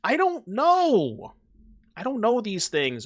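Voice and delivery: high, drawn-out protest of ignorance; exasperated protest. Real, not acted. Streamer defending himself to chat over language mix-up, exasperated protest.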